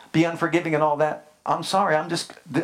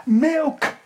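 mock baby cry; 11 semitones higher, 4 dB louder than usual. Baby crying for milk.

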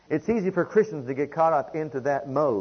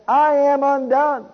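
high, loud, slow cry of I am undone; loud anguished cry; 11 semitones higher, 9 dB louder, slower than usual. Acted. Isaiah crying out before the Lord, loud and high. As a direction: cry it out as a man overwhelmed before God. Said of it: "he said".

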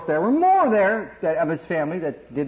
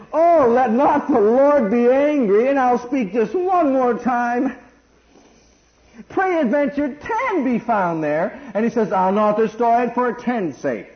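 slow, drawn-out pleading read, louder; solemn scripture reading; 5 semitones higher, 5 dB louder, slower than usual. Acted. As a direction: read the plea slowly, reverently. Reads Abraham's plea from scripture.

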